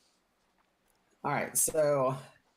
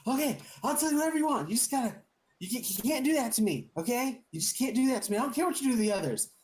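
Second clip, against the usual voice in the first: much higher, fast panicked plea; frantic begging; 10 semitones higher, faster than usual. Acted. Captive bargains with the witch, fast high panic. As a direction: gabble it out to save yourself. Captive begs, betrays others.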